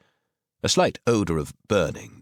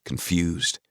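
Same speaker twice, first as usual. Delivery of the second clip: usual speaking voice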